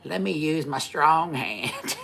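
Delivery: high, slow mock movie line; Scary Movie quote. Teasing his wife, a slow high mock movie line. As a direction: draw it out high as a running joke. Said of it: "so I'm always like".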